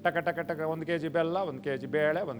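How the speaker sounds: lightly mocking list prayer; mock grocery-list prayer. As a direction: mimic a careless believer reading demands to God.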